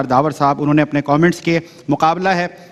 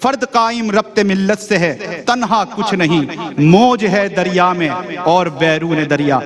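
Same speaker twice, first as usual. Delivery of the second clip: poetic recitation; 2 semitones higher than usual. Acted. Host recites Iqbal couplet.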